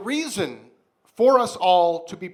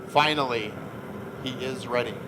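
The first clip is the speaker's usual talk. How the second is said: dramatic narration; 3 semitones lower than usual. Acted. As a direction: narrate it like a grim fantasy epic.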